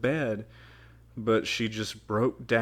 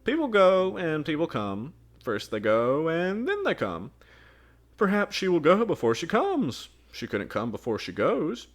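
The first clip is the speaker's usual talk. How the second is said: higher, louder sing-song riddle; girl's odd riddle; riddling girl; 9 semitones higher, 6 dB louder than usual. Acted. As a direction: tease them with nonsense.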